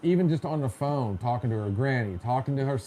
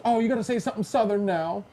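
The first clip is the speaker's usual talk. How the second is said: lightly mocking, higher-pitched eager request; a hint of a pushy knucklehead, pitched up; 7 semitones higher, faster than usual. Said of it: "one of those knuckleheads that goes".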